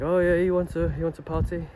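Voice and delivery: put-on high, light voice of an old Korean man; old man imitation. Old stranger offering a night out, high mock voice. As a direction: pitch it up to mimic the stranger's offer.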